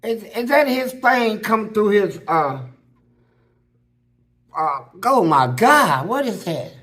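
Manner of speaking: rising alarm, slowed on oh my God; shocked woman. Retold or imitated. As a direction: play the startled nurse stammering in shock. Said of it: "She said".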